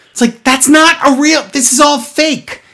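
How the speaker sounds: high-pitched exasperated protest; mock indignation. Host objecting to fake rewind noises, mock indignant. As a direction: pitch it up in hammed-up outrage.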